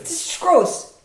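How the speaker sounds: high, loud disgusted whine; grossed-out teen. Retold or imitated. Repeating nephew's line.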